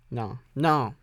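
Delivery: ordinary delivery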